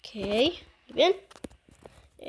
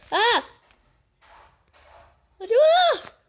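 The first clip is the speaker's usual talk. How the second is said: panicked cry; 9 semitones higher, 5 dB louder, much slower than usual. Pretend. Plane falling.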